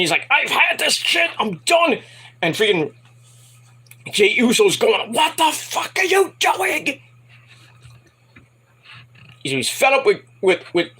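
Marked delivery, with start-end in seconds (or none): 0.3-2.0 high, strained outburst; hammed-up exasperated yell; fed-up mock shout. Acting out fed-up wrestler's outburst. 5.1-7.0 high, drawn-out outraged yell; slow, strained shriek of outrage; appalled mock shout. Acting out shocked teammate yelling.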